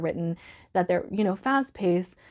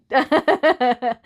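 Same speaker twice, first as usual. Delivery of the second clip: laugh; 5 semitones higher, 7 dB louder than usual. Real, not acted. Emotional laugh.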